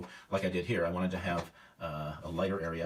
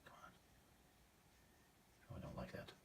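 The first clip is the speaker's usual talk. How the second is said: muttered aside; 21 dB quieter, faster than usual. Real, not acted. Painter unhappy with own stroke.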